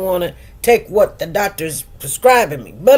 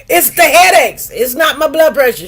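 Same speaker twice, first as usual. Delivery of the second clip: higher, louder insistence; loud protest; 7 semitones higher, 7 dB louder than usual. Real, not acted. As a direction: push back hard and loud. Arguing back at her doctor.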